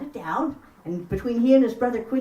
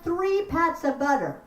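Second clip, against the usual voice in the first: ordinary delivery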